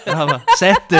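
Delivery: loud laughing bid; shouting a bid through laughter. Pretend.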